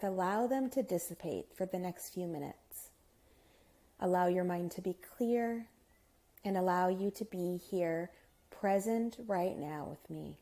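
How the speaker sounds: soft, hushed meditation guide with unhurried pauses; guided meditation. Acted.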